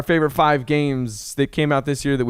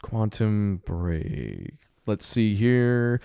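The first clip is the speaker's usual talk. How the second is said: slow, drawn-out and softer; slow musing; 2 semitones lower, 3 dB quieter, much slower than usual. Real, not acted. Co-host checking his list for the game, slow musing. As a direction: stretch the words out while searching.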